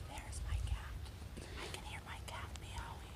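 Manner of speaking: hushed whisper while listening. Real, not acted. Teacher on her listening walk for students, whispering. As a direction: whisper so you don't cover the sounds.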